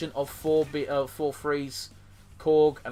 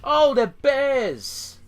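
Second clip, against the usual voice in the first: loud, high, drawn-out exclamation; stretched-out shout of surprise; excited surprise; 9 semitones higher, 7 dB louder, much slower than usual. Real, not acted. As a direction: cry out in delighted discovery. Spots bears in the game.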